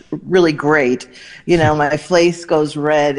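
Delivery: ordinary delivery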